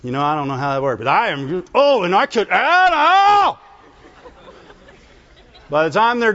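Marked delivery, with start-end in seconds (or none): 0.0-3.6 person working themselves up. Retold or imitated. Preacher voicing someone stewing into anger, building to a mock yell. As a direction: start mild, then climb into a hammed-up outburst. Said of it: "you can just see people".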